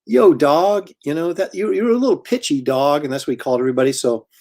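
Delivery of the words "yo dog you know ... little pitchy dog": high, hyped-up judge voice; Idol judge impression. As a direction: play the laid-back music judge. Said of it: "he'd always go".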